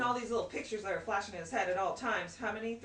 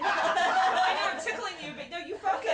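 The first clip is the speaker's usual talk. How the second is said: laughing tease; 8 semitones higher, 6 dB louder than usual. Retold or imitated. Voicing mom teasing the child.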